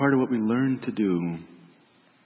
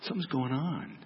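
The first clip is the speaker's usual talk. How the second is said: concerned inner voice; 4 semitones higher, 8 dB quieter than usual. Acted. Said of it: "your heart as a parent is listening".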